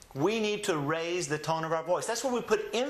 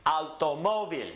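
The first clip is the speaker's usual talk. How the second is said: Automóvil with MÓ raised, then falling on vil; stress on MÓ. Shown as a demonstration. Teacher modelling the accent rule, raised stressed MÓ. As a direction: lift your tone on the MÓ of automóvil. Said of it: "raise the tone of your voice on that syllable".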